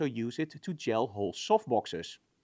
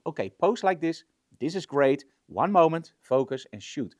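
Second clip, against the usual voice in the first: louder, brisk instructions to a model; calling directions; 3 semitones higher, 6 dB louder than usual. Acted. Photographer talking a model through a pose, calling directions.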